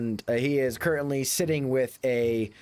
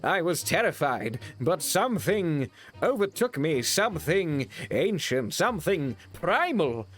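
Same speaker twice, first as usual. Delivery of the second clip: higher, grand heroic boast, slowing; pompous toff spinning an epic tale; hammed-up bragging Englishman; 5 semitones higher than usual.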